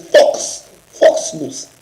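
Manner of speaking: whispery attempt at a British accent on Fox News. Co-host mocking the Fox News name in a British accent. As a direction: try out a rough British accent, half joking. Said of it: "I gotta work on my British accent".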